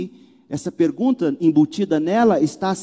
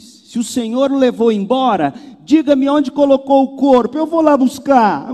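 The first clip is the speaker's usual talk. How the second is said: higher, louder pleading demand from Mary; insistent Mary; 7 semitones higher, 7 dB louder than usual. Acted. Mary demanding the body from the gardener, pleading and insistent. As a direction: beg the gardener to hand the body over. Pastor voices Mary's demand.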